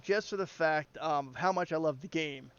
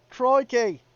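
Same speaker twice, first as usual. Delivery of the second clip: loud, high Australian Crikey; hammed-up Aussie exclamation; Aussie accent; 7 semitones higher, 9 dB louder than usual. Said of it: "Say something with your Aussie accent".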